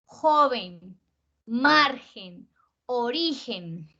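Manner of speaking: "joven", "margen", "origen" con JO, MAR, RI alargadas; fuerza en la penúltima. Shown as a demonstration. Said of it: "que como hacemos fuerza". (palabras separadas, JO-ven, MAR-gen, o-RI-gen)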